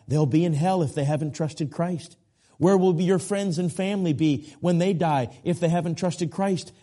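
normal talking voice

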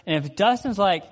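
ordinary delivery

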